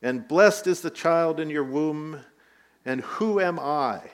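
loud, earnest blessing spoken over a guest; loud proclamation. Retold or imitated. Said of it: "this is what she said in a loud voice".

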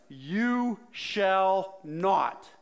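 loud, very slow, booming command; Gandalf voice. Retold or imitated. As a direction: Peter refusing like a wizard barring the way. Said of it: "think of this in almost that Gandalf voice".

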